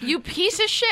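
squeaky, high-pitched mock insult; high playful insult. Real, not acted. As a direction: spit it out in a high yelp.

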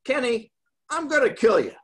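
restrained mock threat, pitch lifted; retold enraged threat. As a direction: act out a mild rage. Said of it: "he just went into a, a rage".

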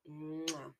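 soft kiss sound at the end; blowing an air kiss. Real, not acted. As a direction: blow a quiet kiss goodbye. Kiss sign-off.

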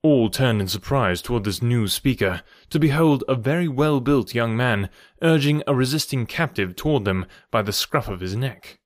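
ordinary delivery, nothing marked

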